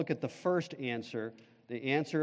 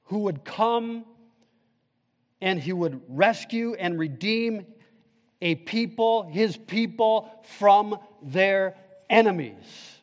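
higher, slow, building preacher cadence; preaching at full force; 4 semitones higher, 4 dB louder, slower than usual. Acted. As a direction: drive it home to the congregation. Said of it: "now listen carefully".

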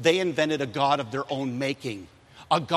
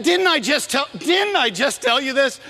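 Moses scolding; 10 semitones higher, 5 dB louder than usual. Retold or imitated. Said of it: "he says that".

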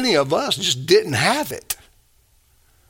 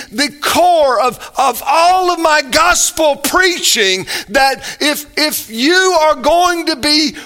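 emphatic preaching; 8 semitones higher, 4 dB louder than usual. Acted. Preacher voicing Jesus emphatically.

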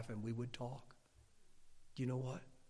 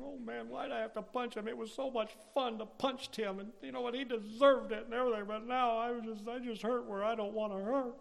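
high, whiny imitation of a sorry kid; mock crying; 9 semitones higher than usual. Spanked boy alone in his room, mock crying. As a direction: whimper self-pityingly as the punished child. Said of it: "And I cried".

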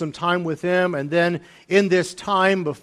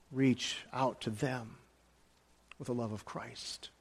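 slow, much softer, almost fading out; tender and hushed; 4 semitones lower, 13 dB quieter than usual. Real, not acted. Pastor softly urging his flock to reach out with love. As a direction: lay it down gently like a blessing. Tender close of a sermon point.

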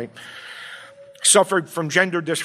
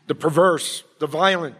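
usual speaking voice

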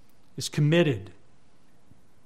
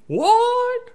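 high, loud comic squawk of disbelief; mock high-pitched exclamation; Maurice; 9 dB louder than usual. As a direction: imitate him with a very high-pitched loud yelp. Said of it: "You know how you would talk".

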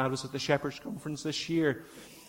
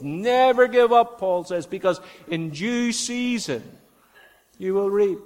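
preaching emphasis; 6 semitones higher, 7 dB louder than usual. Real, not acted. Preaching climax.

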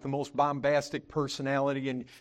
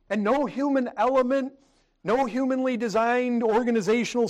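raised, driving pulpit voice; full-force preaching; 8 semitones higher, 5 dB louder than usual. Acted. Pastor preaching to the brethren, raised and driving. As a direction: preach it hard to the congregation.

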